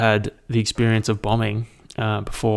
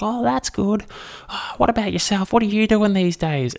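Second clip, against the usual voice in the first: overheard phone-call lady; 11 semitones higher than usual. Retold or imitated. Comic mimicking an overheard lady on speakerphone, high mock voice. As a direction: put on a high voice for small talk.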